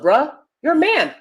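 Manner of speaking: ordinary delivery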